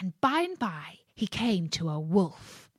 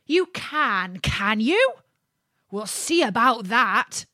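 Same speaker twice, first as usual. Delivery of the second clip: louder, scornful dare; doubting challenge; 3 semitones higher, 4 dB louder than usual. Acted. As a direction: dare him to try. Ditch diggers challenge.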